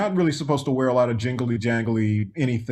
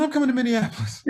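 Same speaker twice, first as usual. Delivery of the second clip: Michael impression; 10 semitones higher, faster than usual. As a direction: lift the voice into a gentle high Michael. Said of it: "He said".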